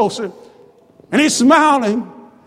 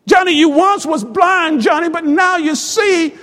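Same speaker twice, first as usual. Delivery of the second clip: raised, emphatic preacher's delivery; joyful proclamation; 3 semitones higher than usual. Acted. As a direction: proclaim it to the returning son with fervour. Father repeats it with rising joy.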